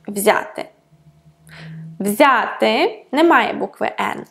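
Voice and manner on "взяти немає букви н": normal talking voice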